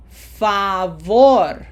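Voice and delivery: Favor in Spanish, VOR loud and long; stress on last syllable. Shown as a demonstration. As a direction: punch the final VOR of Favor. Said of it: "put pronunciation emphasis in that syllable number one".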